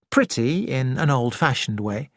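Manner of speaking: ordinary delivery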